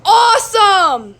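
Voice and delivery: loud, slow, delighted exclamation; loud slow joyful cheer; thrilled shout. Acted. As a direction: cheer it with joy. Amelia thrilled after crash.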